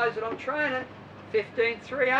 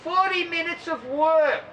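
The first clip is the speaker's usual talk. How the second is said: higher, drawn-out instructor call; aerobics teacher call; 5 semitones higher, 4 dB louder than usual. Retold or imitated. Aerobics teacher calling out to the class, slow and high. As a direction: sing it out like a gym class teacher. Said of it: "the aerobics teacher over there yell out".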